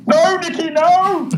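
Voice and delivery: high-pitched mock plea; mock pleading cry. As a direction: squeal it like a terrified victim. Dad mimics people pleading with him.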